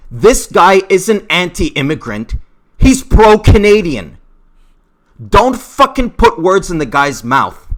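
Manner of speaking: loud, heated defense; worked-up, emotional. Real, not acted. Fan defending Don Cherry from accusers, emotional outburst. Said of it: "I'm sorry for my tone of voice".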